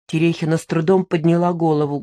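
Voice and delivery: normal talking voice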